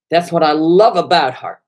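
loud, punchy comeback; emphatic retort. Retold or imitated. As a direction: fire it back at full volume.

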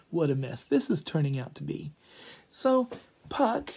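ordinary delivery

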